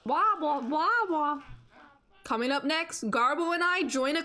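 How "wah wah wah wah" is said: sing-song falling wah wah; mock the flop like a sad trombone. Mocking a failed joke.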